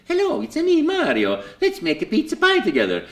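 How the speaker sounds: put-on bubbly joy for the kids; Mario voice. Mario's audition line to camera, very high and cheerful. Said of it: "I heard action".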